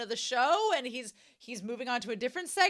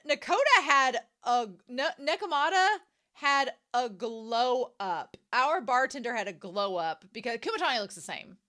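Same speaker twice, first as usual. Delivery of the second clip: ordinary delivery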